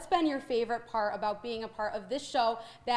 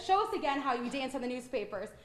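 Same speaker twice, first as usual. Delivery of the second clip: usual speaking voice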